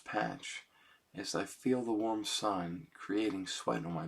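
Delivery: soft, slow reading of his own lyrics; slow, quiet lyric recital; poem reading. Acted. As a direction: let each line land gently, slowly.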